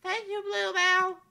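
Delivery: put-on high, bright young princess, wistful; a lovely princess doubting fairy tales; princess Amelia voice.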